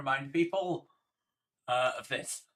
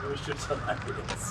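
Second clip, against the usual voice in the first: usual speaking voice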